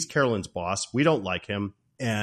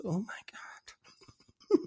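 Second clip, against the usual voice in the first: slow, high breathy mock awe; stunned aside; 10 semitones higher, 7 dB quieter, much slower than usual. Jack seeing Joan in red lingerie, breathless mock awe. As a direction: wheeze it slowly, imitating an overwhelmed man. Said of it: "he just goes".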